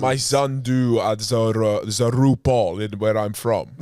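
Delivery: slow, halting mock-Russian; Russian accent. Russian dad describing his son, slow Russian accent. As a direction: grope slowly through a Russian accent. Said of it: "how do you do Russian accent".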